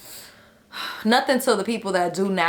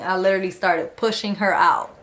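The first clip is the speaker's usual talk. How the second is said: ordinary delivery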